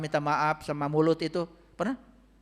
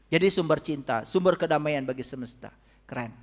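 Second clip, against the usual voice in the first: ordinary delivery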